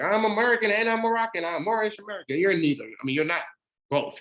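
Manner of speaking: usual speaking voice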